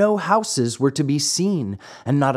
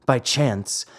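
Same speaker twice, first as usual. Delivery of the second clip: ordinary delivery, nothing marked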